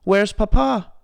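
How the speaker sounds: high, loud frightened cry; desperate for her father; frantic. A narrator's reading. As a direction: Belle panicking over Papa. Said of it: "asked Belle".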